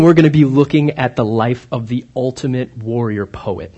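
ordinary talk, nothing marked

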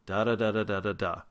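da da da dropping at the end; falling tune. Shown as a demonstration. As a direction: hum the falling American melody. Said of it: "most of our sentences go down".

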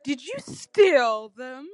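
loud, drawn-out scoffing question; scoff, stretching the accusation out. A narrator's reading. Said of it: "scoffed Hecate".

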